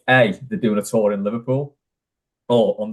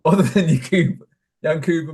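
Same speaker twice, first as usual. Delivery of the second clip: laughing; 6 semitones higher, 4 dB louder than usual. Real, not acted. Restarting while still laughing.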